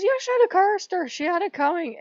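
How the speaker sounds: high, loud, gloating goddess voice; she's pleased with the cruelty; vindictive goddess. Retold or imitated. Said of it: "And she says".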